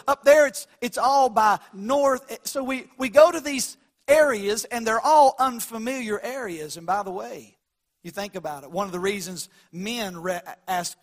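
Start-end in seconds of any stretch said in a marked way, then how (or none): 0.1-6.8 loud preaching. Real, not acted. Preaching builds.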